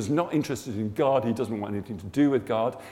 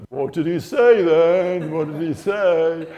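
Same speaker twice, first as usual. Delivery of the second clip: slow, raised, loud taunt; mocking; 4 semitones higher, 8 dB louder, slower than usual. Workmates taunting [name] about what God said, mocking. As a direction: draw the question out in a teasing jeer. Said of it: "they're all mocking me".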